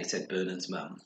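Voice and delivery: ordinary delivery, nothing marked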